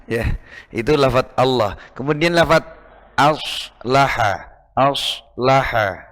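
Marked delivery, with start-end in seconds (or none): 3.1-6.0 aslaha drawn out with a plain first a; slow correct aslaha. Shown as a demonstration. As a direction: model aslaha slowly. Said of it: "kalau tidak, eh, biasa". (aslaha pelan dan benar)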